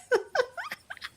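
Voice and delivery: high, breathy giggle. Real, not acted. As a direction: giggle at the idea. Laughs at the idea.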